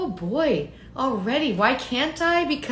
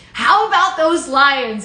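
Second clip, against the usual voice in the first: a hint of a gruff coach, louder; Dan Campbell; 3 semitones higher, 8 dB louder, slower than usual. Host reads an all-caps Lions comment, mock coach shout. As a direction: read the caps like a fired-up coach, slow and loud. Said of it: "Why do I hear that in Dan Campbell's voice?".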